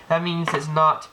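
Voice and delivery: normal talking voice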